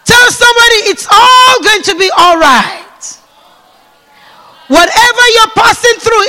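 loud, high preaching shout; shouting sermon; full-force preaching. Real, not acted. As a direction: rouse the congregation.

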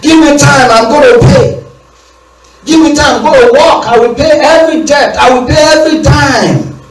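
high pleading plea, rising to a shout; begging servant. Retold or imitated. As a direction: beg the master desperately for time. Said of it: "He begged".

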